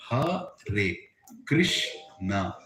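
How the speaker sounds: normal talking voice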